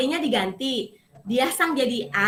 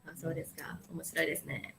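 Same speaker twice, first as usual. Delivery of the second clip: much softer, lower and uninterested; bored reply; 6 semitones lower, 15 dB quieter, faster than usual. Retold or imitated. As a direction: mumble it without interest. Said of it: "Ini kan". (meniru jawaban lesu dan pelan)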